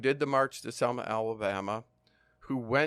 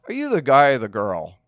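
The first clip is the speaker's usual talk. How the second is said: taunting question; 8 dB louder than usual. Retold or imitated. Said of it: "And he says".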